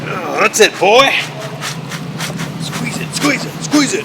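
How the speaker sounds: excited cheering. Acted. Egging on a hard squeeze.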